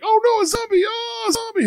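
high mock panicked shout; imitating son's yell. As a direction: yell like a kid fleeing zombies in the game. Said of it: "He's like".

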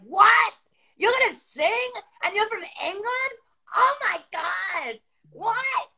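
high-pitched, stretched-out astonishment; over-the-top squealed disbelief; mock amazement. Imitates couple's amazed reaction.

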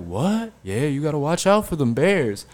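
high, casual grandpa shrugging it off; high mock old man voice; imitating grandpa. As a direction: act like the bear is nothing.

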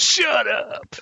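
high, squealing mock shout. Retelling the villain's outburst to his co-host, a high mock yell. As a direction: yelp it like a startled villain. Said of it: "So he goes".

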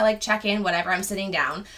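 normal talking voice

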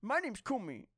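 high-pitched mocking imitation; high, whiny mock complaint; mocking Cool Me. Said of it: "he's always like".

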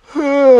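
big yawn, loud and high; a real open-mouthed yawn. Real, not acted. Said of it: "Excuse me".

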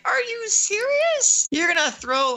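high, loud, incredulous protest; mock outrage. Viewer scolding the show over a bombshell, mock outrage. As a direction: stretch it out in hammed-up disbelief.

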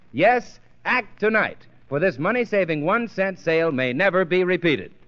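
usual speaking voice

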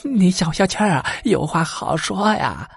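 calm him down, begging; soothing old wife. Acted.

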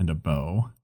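lightly voiced awkward player describing his elf; mock Dusty voice. Imitating Dusty picking his character.

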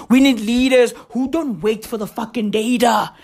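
normal talking voice

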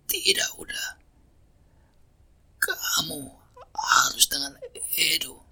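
high, strained cracking voice; strained father voice. Acted. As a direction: force it out through weakness. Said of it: "jawab ayahnya".

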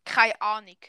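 Swiss German dialect, pronounced firmly; emphatic Swiss German demo. Shown as a demonstration. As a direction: teach the phrase in Swiss German. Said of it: "das heißt".

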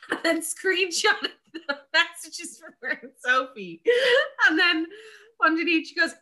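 high-pitched, helpless laughter through the words; laughing while talking. Real, not acted. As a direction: struggle to tell it through real laughter. Cracking up while retelling story.

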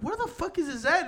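a mock wide-eyed disbelief; amazed. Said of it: "And I was like".

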